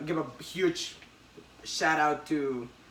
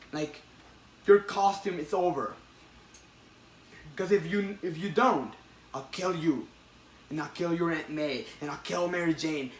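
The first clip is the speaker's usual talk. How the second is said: louder, building to a yelled threat; menacing threats; 2 semitones higher, 4 dB louder than usual. Retold or imitated. Retelling the comic, the Goblin threatens Peter's loved ones, menacing. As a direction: stack the threats up to a roar.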